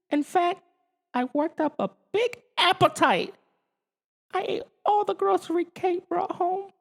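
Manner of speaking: high alien voice, cheeky apology; sheepish alien. A narrator's reading. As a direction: confess the mess like a cheeky alien. Alf's voice in the story.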